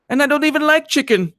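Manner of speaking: hammed-up Eastern European complaint; Eastern European accent. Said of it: "And she talked like this".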